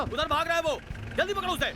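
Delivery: very high-pitched chase cry; urgent calling out. Acted. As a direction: call out urgently after a runaway. (ऊँची आवाज़ में पुकारते हुए)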